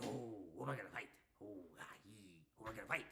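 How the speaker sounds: low, very soft mock muttering; hushed, low wrestler impression; Randy Orton/character. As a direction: wonder aloud under your breath. Acts out Randy Orton wondering.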